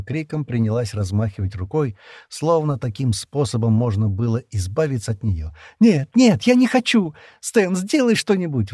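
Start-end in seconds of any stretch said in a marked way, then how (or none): 5.8-8.7 high, loud hysterical screaming; high panicked shrieks, slowing into a plea. A narrator's reading. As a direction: scream in hysterics, losing control. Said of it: "в истерике закричала она".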